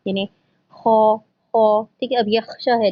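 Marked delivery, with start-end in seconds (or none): 0.7-1.8 خا، خا with an echoing rebound, slowly; slow demo of خا، خا, the sound bouncing back. Shown as a demonstration. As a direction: let خا bounce back at the end. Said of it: "قلقلہ letter کیا ہوتا ہے".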